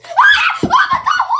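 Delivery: frightened screaming at the pop; scared shriek. Acted. Scared person popping a fidget.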